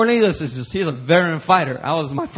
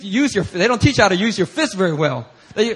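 usual speaking voice

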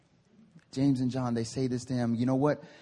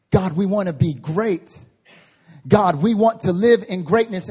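bold prayer; 4 semitones higher, 8 dB louder than usual. Pretend. Disciples' prayer voiced.

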